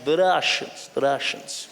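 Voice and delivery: put-on Russian accent. Savouring the menacing Russian name.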